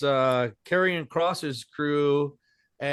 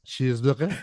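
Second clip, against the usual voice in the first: mock French Cheeseburger; 3 semitones lower, 3 dB quieter than usual. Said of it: "Can you say cheeseburger".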